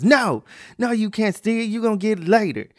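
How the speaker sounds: loud, high mock mother's refusal; scolding mom. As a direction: firmly shut the kid down as mom. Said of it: "the mom goes".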